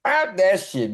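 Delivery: laughing through the words, hyped. Real, not acted. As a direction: crack up while cutting the track off. Laughing reaction to the track.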